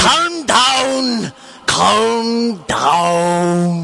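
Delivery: drawn-out mock German shout; exaggerated, stretched fake German accent; bad German accent. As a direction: call it out in a hammed-up German accent. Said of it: "had the worst German accents".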